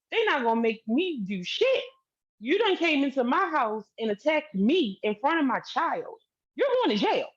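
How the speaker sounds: mock defiant outburst, rising toward the end; retold defiant rant. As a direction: imitate him daring you, voice climbing as it goes. Retells man's defiant rant.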